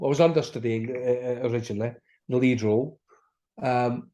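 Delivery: normal talking voice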